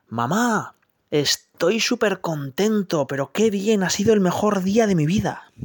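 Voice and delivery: high, delighted little donkey voice; a young donkey bragging to his mother; proud young donkey. Acted. Young donkey tells his mother about his big day, high and delighted. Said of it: "le cuenta a su mamá".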